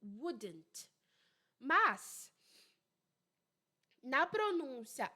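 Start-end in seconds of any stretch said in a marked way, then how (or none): 0.0-0.9 WOULDN'T with a clearly sounded D and final T; full-D pronunciation. Shown as a demonstration. Teacher showing students the odd contraction, full-D pronunciation. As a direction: say WOULDN'T low, both consonants kept. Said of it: "olha que engraçada a pronúncia".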